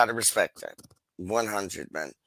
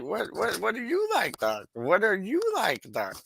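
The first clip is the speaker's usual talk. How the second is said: cheeky high teasing; 8 semitones higher than usual. Acted. Teasing Doc with laughs.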